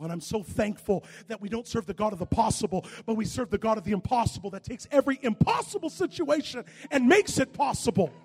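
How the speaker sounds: rising from steady to loud high preaching; building to full preaching. Real, not acted. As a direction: build the crowd up to a roar.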